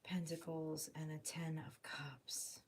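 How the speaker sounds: hushed whispered card names. Real, not acted. Tarot reader whispering the cards in awe.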